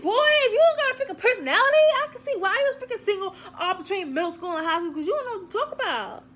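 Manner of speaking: loud, high, sassy roast; high and loud, sassy clapback; sassy inner retort. Retold or imitated. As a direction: let him have it, mock outrage. Sassy retort in her head.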